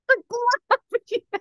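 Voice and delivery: high giggling reply; laughing. Retold or imitated. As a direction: burst out mid-laugh. Boyfriend's joke voiced laughing.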